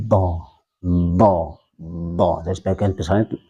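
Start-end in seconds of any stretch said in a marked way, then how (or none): 0.1-2.3 "do" with the tongue pressed to the palate; tongue-to-palate dhad. Shown as a demonstration. Teacher showing itbaq to students, palate-pressed "do". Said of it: "ditempelkan ke langit-langit atas".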